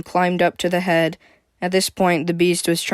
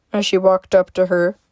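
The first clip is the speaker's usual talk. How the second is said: ordinary delivery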